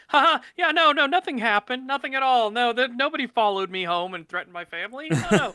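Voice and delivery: high, loud, hammed-up nothing-happened voice; mock cheerful cover-up of a threat; sarcastic fake denial. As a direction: play the innocent, overdoing it. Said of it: "is to be like".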